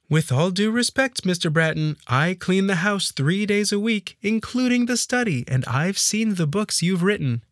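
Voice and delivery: high-pitched maid's voice, firm but respectful; insistent maid. Acted. Maid correcting her employer about his books, respectfully insistent. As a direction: stand your ground as the maid, pitched well up.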